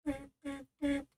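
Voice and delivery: high, quiet beep beep; beeping noise. Pretend. Joking about being old, a soft high beep. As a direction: mimic a hospital monitor.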